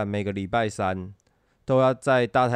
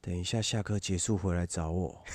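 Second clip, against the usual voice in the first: stern squad leader; 5 semitones lower, 10 dB quieter than usual. Retold or imitated. Voicing the strict squad leader.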